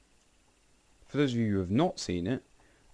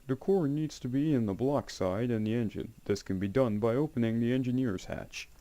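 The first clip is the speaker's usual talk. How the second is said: deliberate, higher-pitched instruction-manual voice; manual read-aloud; 4 semitones higher than usual. Acted. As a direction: read it out like a formal technical manual. Reads an in-game manual aloud.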